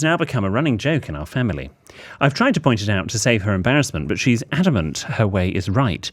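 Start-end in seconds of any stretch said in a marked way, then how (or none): none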